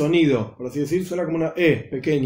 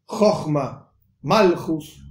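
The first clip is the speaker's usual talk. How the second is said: "Chochma, Malchus" as CHOCH-ma, MAL-chus; stress before the last syllable; much slower than usual. Shown as a demonstration. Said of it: "poniendo el acento antes del final de la palabra".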